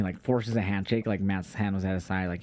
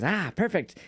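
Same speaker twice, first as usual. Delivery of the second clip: lightly voiced Danhausen; 8 semitones higher, slower than usual. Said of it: "And he goes".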